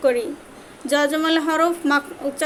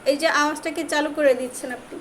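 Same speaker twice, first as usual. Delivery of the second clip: ordinary talk, nothing marked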